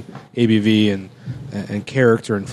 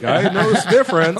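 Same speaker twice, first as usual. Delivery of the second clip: imitating him in a higher voice; lightly mocking, pitched-up retort; mock quote; 10 semitones higher than usual. Co-host teasing about Ira's near challenge, high mock quote. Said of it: "He's like".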